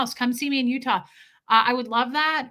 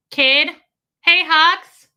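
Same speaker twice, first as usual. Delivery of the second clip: high, loud call across the house; 5 semitones higher, 10 dB louder, much slower than usual. Real, not acted. Calling to Hux in the house, loud and high. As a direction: call him from another room.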